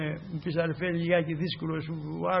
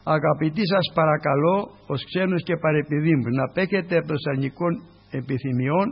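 louder formal reading; scripture reading; 4 dB louder than usual. Acted. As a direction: read the apostle's plea aloud. Reads Peter's letter.

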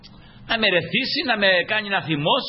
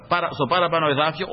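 ordinary delivery

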